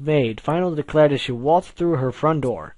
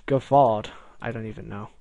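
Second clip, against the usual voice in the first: ordinary delivery, nothing marked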